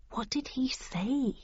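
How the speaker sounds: softly puzzled aside; hushed, slow query to a companion; quiet question. A narrator's reading. Narrator asks quietly amid muttering.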